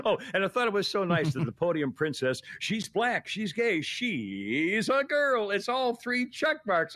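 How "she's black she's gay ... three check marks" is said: high, drawn-out sarcastic cheering; mock excitement. Guest mocking a press secretary's appointment, gushing sarcastically. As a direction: gush with fake delight, stretching the words.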